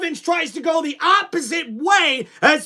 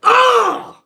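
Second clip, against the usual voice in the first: anguished groan; 7 semitones higher, 5 dB louder than usual. Real, not acted. Groan at final score.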